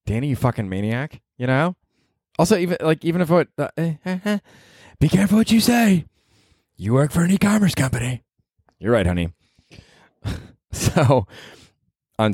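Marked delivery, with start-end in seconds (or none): 3.8-8.2 higher, pointed warning voice; mock wife warning. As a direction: play the wife cautioning him to watch his words. Wife warns him, he answers honey.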